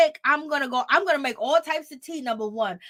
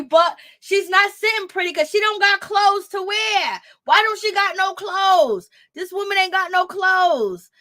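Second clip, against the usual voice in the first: high, loud incredulous rant; exasperated shout; 7 semitones higher, 5 dB louder than usual. Real, not acted. Ranting at the screen about clothes, loud disbelief. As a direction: throw up your hands and yell it.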